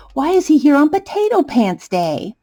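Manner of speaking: usual speaking voice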